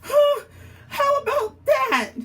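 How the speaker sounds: high, loud startled cry; surprised. Acted. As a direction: react to the bad news, caught off guard. Surprised it's Wednesday.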